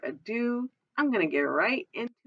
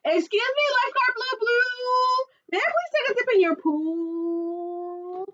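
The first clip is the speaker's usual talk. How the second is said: much higher sing-song asking; sing-song monster; 10 semitones higher than usual. Acted. Space monster asks the lifeguard, sing-song. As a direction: lilt the request.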